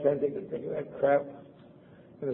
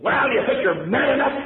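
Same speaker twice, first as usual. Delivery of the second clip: high, loud goading challenge; taunting; 9 semitones higher, 5 dB louder than usual. Retold or imitated. Sailor goading the kid to take a swig, high taunting dare. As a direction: dare him to drink.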